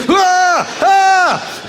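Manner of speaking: sudden high squeal of shock; startled high yelp. Retold or imitated. Comic caught looking at breastfeeding, high startled yelp. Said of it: "and you go".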